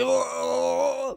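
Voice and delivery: high laugh. Real, not acted. Laughing at own joke.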